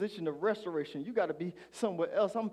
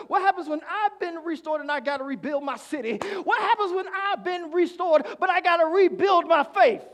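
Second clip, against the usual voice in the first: higher and louder preaching build; preaching climax; 8 semitones higher, 6 dB louder than usual. Acted. Preacher asking the restored about rebuilding, building to a shout. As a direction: climb with each question.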